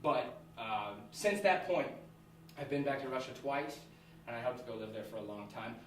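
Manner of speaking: ordinary talk, nothing marked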